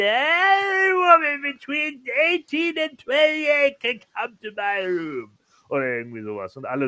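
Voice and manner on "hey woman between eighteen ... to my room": put-on strut it like a cocky old wrestler; old wrestler promo. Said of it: "wo er meinte".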